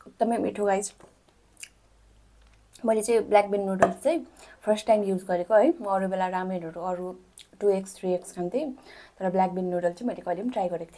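talking between bites of food; speaking with food in mouth; mouth full, chewing. Real, not acted. Seen 0: Took bites just before; holds food at mouth, talks mid-chew.

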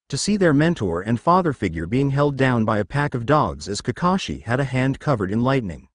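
plain reading voice

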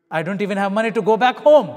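loud, high cry of despair; desperate outburst. Real, not acted. Preacher reliving being broke and hungry, loud despair. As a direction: let the hopelessness burst out loud. Desperate, penniless on third day.

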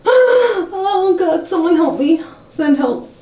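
high, crying-tinged plea for help; half-joking cry for rescue; mock panic. Real, not acted. Panicking over wig haircut.

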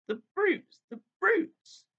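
high, strained cry of distress; tearful outrage. A narrator's reading. Lucy horrified at the creatures, a high tearful cry. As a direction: cry it out high through tears. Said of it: "tears streaming down her cheeks".

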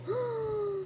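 sharp, high intake of breath; delighted gasp. Real, not acted. Player spotting a kitty, delighted gasp. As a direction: gasp at a cute surprise.

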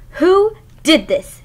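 grumpy dinosaur half-bellowing; mild bellow. Acted. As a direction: bellow it out to the whole forest. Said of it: "she bellowed".

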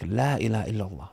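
low, soft, reverent recital of the shahada; quiet recitation. Retold or imitated. Recalling Fajr, he softly recites the shahada. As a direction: hush it like a dawn prayer.

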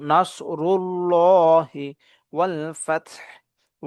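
slow chant with a full, thick l in "নাসরুল্লাহি"; drawn-out, heavy "llah" in "নাসরুল্লাহি"; mouth-filling heavy lam. Shown as a demonstration. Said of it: "মুখ ভর্তি করে আওয়াজ করব".

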